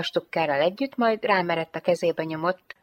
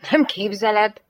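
indignant, higher and louder; indignant snort; 4 semitones higher, 5 dB louder than usual. A narrator's reading. Lieutenant to Jane about her shirt, indignant. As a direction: snap back in mild indignation. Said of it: "hörrent a hadnagy".

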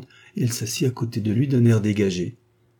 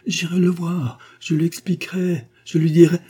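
frantic pleading; 9 semitones higher, 4 dB louder than usual. A narrator's reading.

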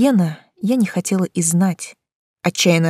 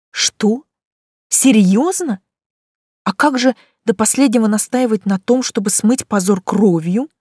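astonished; 3 semitones higher than usual. A narrator's reading. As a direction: burst out in disbelief. Heroine stunned by the deal.

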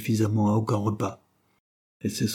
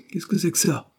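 higher-pitched questioning cry; exclaiming; 8 semitones higher than usual. A narrator's reading. Dare's son spotting the ten francs, exclaiming. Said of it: "cria-t-il en apercevant".

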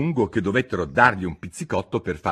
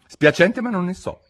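alarmed; 11 semitones higher, 6 dB louder than usual. Acted. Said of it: "piuttosto allarmata".

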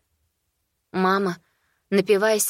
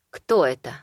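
stern question; 5 semitones lower than usual. A narrator's reading. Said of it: "строго вопрошают они".

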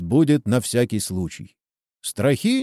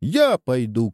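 ordinary delivery, nothing marked

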